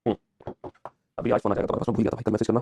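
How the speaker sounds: normal talking voice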